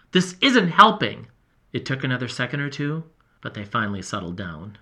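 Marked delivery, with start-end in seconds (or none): 0.1-1.3 raised voice. A narrator's reading. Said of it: "I yelled at both of them".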